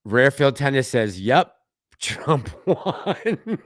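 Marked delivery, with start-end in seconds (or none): 1.2-3.7 laughing while reading. Real, not acted. Laughs reading chat message.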